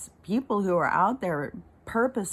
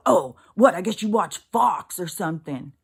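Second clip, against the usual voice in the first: loud, scornful mock retort; sarcastic mock attack, louder; mocking sneer; 4 semitones higher, 7 dB louder than usual. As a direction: sneer it back like a snide commenter. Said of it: "The response would be".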